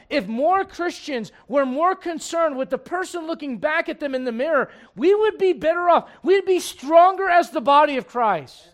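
high, loud pulpit preaching; fiery preaching. Acted. Preacher rails at the congregation about judging. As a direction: preach it out full force.

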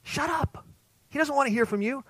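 high, scolding hushed snap; hushing crowd. Acted. Crowd silencing the blind man, high scolding snap. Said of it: "They said".

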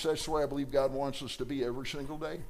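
ordinary talk, nothing marked

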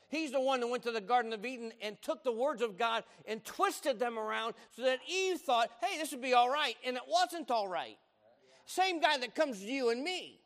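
rising preacher's build; preaching. Acted. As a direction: preach it with mounting fervour. Preaching on the devil.

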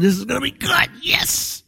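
giddy anticipation. Acted. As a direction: voice climbing high into a squeal. David eager to watch battle.

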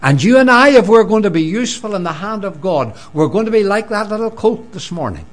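full-force sermon delivery; fervent preaching. Acted. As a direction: preach it out to the congregation.

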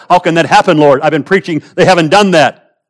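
high, loud, exasperated prophet; acted-out incredulous objection; agitated protest. Acts out prophet protesting to God.

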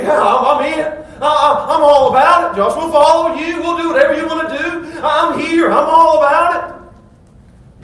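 high, loud, gushing eagerness; eager church member. Retold or imitated. Churchgoer telling pastor he's in, gushing eager. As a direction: gush your commitment to the pastor. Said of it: "they're like".

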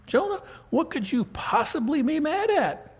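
high, astonished question; incredulous. Acted. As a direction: ask Jonah as if baffled. Preacher addresses Jonah in disbelief.

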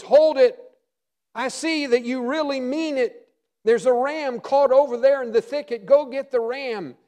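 the Lord halting Abraham's sacrifice; urgent halt. Acted. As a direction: stop him short, then direct. God stops Abraham's knife.

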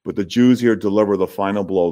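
normal talking voice

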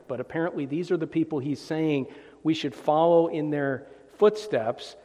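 ordinary talk

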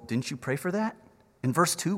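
ordinary talk, nothing marked

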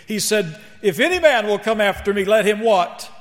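high, loud driving sermon delivery; preach. Real, not acted. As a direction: hammer out each contrasting motto, then build to the Lord's call. Preaches at full force.

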